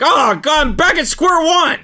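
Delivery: very high, loud cry; hammed-up high shriek of despair; despairing wail. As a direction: wail it in mock anguish. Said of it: "and he's like".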